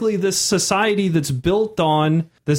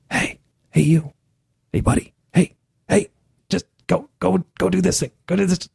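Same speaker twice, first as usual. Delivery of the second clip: whispering spirit; 3 semitones higher than usual. Retold or imitated. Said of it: "whispering to Sky".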